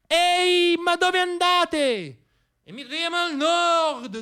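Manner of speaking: molto più acuta e lenta, come sogni che salutano da lontano; voce dei sogni in fuga. Acted. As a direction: chiama dall'alto, trascinando le parole. I sogni che se ne vanno parlano. (sogni che abbandonano l'operaio: voce acuta e lentissima)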